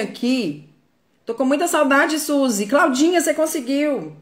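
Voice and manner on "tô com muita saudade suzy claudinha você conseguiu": high, bright-up greeting to fans; excited greeting. Real, not acted. Greeting live-stream viewers in the chat, excited and higher. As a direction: happily call out to viewers by name.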